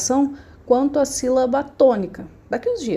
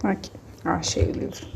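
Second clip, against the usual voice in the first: muttering to herself; 3 semitones lower, 7 dB quieter than usual. Real, not acted. Teacher finds the book, aside.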